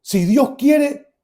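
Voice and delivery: rising to high, loud declaration; emphatic proclamation. Retold or imitated. Son concludes to father it is God, emphatic. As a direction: build phrase by phrase with deliberate pauses. Testimony climax declaring God's power.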